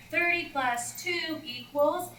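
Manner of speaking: slow, high chant; class reading aloud. Retold or imitated. Class reads the equation together, slow high chant. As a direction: read the equation slowly like a class in unison. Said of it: "you're gonna say".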